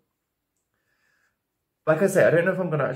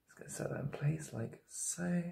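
whisper; 3 semitones higher, 15 dB quieter than usual. Real, not acted. Whispered aside while applying.

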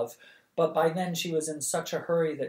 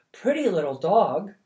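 lightly voiced woman, higher and louder, doting; market woman cooing; 4 semitones higher, 7 dB louder than usual. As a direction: coo over the little dog. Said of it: "remarked a woman".

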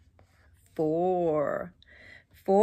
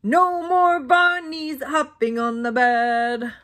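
slow, lightly voiced stern doctor; 2 semitones higher, 4 dB louder than usual. Doctor scolding the bunnies, slow and a touch stern. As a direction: wag a finger slowly, a hint of a stern doctor. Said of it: "the doctor said".

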